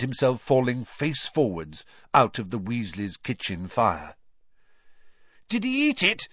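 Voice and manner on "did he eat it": eager, high-pitched question; excited. A narrator's reading. Fred asks as he helps Harry up, excited. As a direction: burst out with keen curiosity. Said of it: "said Fred excitedly".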